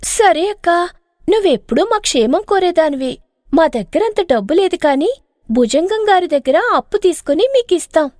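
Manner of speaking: bright and greedy, leaning in; eager young wife. Acted.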